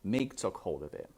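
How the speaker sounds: ordinary delivery, nothing marked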